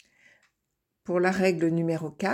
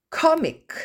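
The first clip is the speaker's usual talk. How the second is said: Comic, loud and high, stress on COM; 10 semitones higher, 6 dB louder than usual. Shown as a demonstration. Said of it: "l'accent tonique se trouve sur la syllabe d'avant". (accent tonique sur COM dans Comic)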